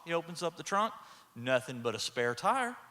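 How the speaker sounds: ordinary delivery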